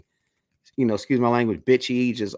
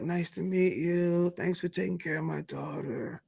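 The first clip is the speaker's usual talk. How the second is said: higher, much softer polite mother's voice; imitating a polite mom; 5 semitones higher, 9 dB quieter than usual. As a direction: play the mom as sweet and soft-spoken.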